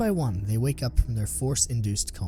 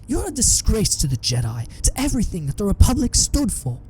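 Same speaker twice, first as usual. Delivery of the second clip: raging Obi-Wan; 5 semitones higher than usual. A narrator's reading.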